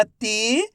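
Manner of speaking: "ती" with a long stretched ee, rising; drawn-out vowel. Shown as a demonstration. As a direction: stretch the vowel of "ती" as a reading demo. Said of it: "खींच के पढ़ेंगे".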